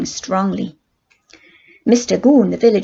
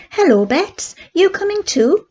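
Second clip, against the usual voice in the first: surprised call; 10 semitones higher than usual. A narrator's reading. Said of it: "called Daisy, surprised".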